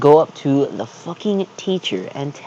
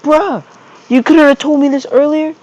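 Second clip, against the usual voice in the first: high, louder disbelieving bruh; exasperated high yell; 14 semitones higher, 6 dB louder than usual. Retold or imitated. Teen exclaiming at his mom, high and exasperated. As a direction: squeal it out in disbelief.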